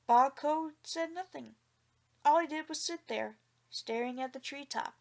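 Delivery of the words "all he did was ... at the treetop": ordinary delivery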